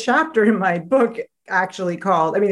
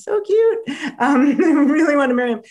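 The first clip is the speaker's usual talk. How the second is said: gushing; 7 semitones higher, 4 dB louder than usual. Retold or imitated. Gushing over childhood crush.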